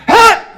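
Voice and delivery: sudden sharp high yelp, very loud; roommate's grunt before peeing; old man grunt. Retold or imitated. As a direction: bark it out like an old man bracing himself. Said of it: "He goes".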